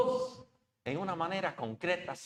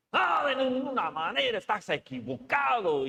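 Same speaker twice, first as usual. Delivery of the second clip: higher, louder, indignant objection; Peter protesting; 8 semitones higher, 4 dB louder than usual. Retold or imitated. Peter rejects Jesus's words, indignant and higher. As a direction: protest like a brash disciple. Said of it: "Y Simón, ¿qué dijo?".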